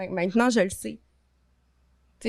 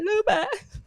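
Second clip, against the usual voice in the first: laughing through tears; 11 semitones higher than usual. Real, not acted. Recalls calling father from hospital.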